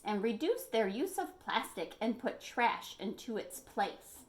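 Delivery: ordinary delivery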